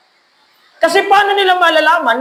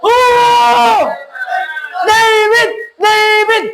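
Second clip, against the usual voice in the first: crowd cheer; 6 semitones higher, much slower than usual. Retold or imitated. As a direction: cheer like the whole army of Israel. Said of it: "sabay-sabay sila nagsigawan". (sigaw ng tagumpay ng Israelita)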